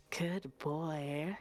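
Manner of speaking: imitated low, slow 'good boy'; mock commanding low purr. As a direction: drop into a deep, teasing voice. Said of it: "with a deeper voice sometimes".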